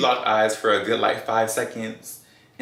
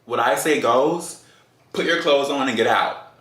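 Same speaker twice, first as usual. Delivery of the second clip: serious, bucking up; 4 semitones higher, 3 dB louder than usual. Retold or imitated. Woman ordering date out of her house, dead serious. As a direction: lay down the law, stretching the words. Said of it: "he saw I was serious".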